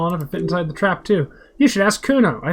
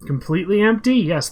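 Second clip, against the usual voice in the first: usual speaking voice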